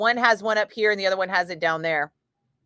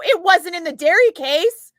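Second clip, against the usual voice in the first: high, louder complaint; protesting quote; 11 semitones higher, 6 dB louder than usual. Retold or imitated. Telling the rep it was missing, high protest. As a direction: push it up in protest. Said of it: "And I go".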